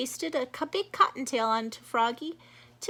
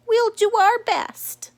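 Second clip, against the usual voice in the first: high, louder rabbit voice; Missus Rabbit; 9 semitones higher, 8 dB louder, slower than usual. Acted. Missus Rabbit agreeing with the chief, high eager voice.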